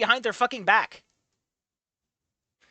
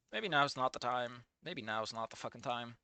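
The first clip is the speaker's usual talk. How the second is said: much softer and lower, under the breath; despairing mutter; 10 semitones lower, 16 dB quieter than usual. Real, not acted. Reader grumbling at the story's timing, despairing mutter. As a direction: mumble it to yourself.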